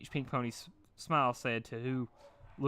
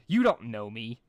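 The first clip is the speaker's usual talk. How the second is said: cold; 8 dB louder than usual. A narrator's reading. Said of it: "narrowing her gaze".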